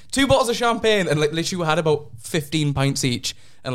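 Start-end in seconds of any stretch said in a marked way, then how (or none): 0.1-1.1 jolly host call. Retold or imitated. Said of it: "he was just like".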